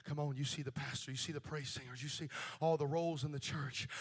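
normal talking voice

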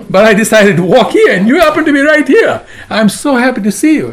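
high, loud joyful outburst with laughter; laughing, elated reunion; very happy. Retold or imitated. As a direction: bubble over with acted delight. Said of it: "and he's very happy".

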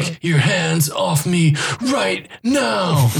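slow hammed-up shout; mock shout. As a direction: bark it out slowly. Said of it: "a shouting match".

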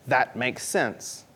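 slow, low and soft; low, unhurried acceptance; matter-of-fact agreement. Acted. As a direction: quietly concede it, taking your time. Same agreement voiced once more.

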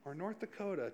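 a whispered joke about bad drivers; mock whisper. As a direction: hush a cheeky aside under your breath. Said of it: "Just kidding".

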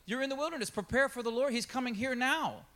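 high, fast prophet's call; paraphrased John the Baptist. Retold or imitated. Preacher voicing John calling the crowd, high and urgent. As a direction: cry it out like a wild-eyed prophet. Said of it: "he's, like, saying".

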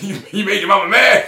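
high, loud laughing tease; teasing dad. Retold or imitated. Dad laughing at his son for upsetting mom, loud teasing. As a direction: chuckle it out like an amused dad.

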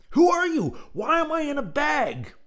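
a first, smaller try at yelling; first take yell. A narrator's reading. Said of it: "Actually, I should say".

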